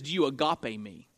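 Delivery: lightly voiced, low searching question; Jesus. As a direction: ask it low and earnestly, then repeat. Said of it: "The first time he says".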